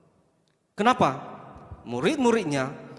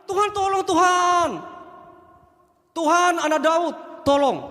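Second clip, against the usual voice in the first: high, loud, slow pleading cry; a woman's desperate plea to Jesus; 11 semitones higher, 4 dB louder, slower than usual. Acted. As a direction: call out desperately for help.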